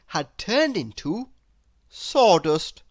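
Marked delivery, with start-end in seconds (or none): none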